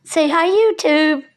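high sing-song prompt. Real, not acted. Coaxing the cat to greet viewers, sing-song.

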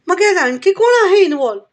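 high, loud, stunned demand; shocked outburst. Acted. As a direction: blurt it out in disbelief. Shocked inspector demands answer. ("मग यात आणखी कोण आहे involve?" धक्का बसून मोठ्याने विचारणे)

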